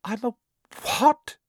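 high gasp, loud on the last word; astonished gasp. A narrator's reading. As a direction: gasp it in disbelief. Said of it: "gasped Harry".

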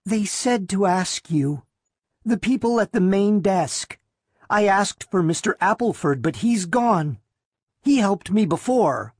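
put-on high, small voice of a timid girl; meek girl, not a true whisper; whispering girl, voiced. A narrator's reading. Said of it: "The girl whispered".

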